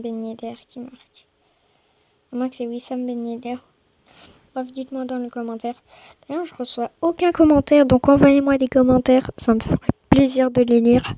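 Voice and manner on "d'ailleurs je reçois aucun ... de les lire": loud, insistent plea to viewers; loud appeal. Real, not acted. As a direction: call out to the audience. Pleading viewers for comments.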